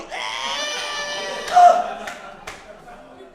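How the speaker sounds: loud, high, drawn-out straining yell. Retold or imitated. Comic re-enacting the heave of a whole bike, straining yell. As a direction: let out a long high effort yell. Lifting the bike over him.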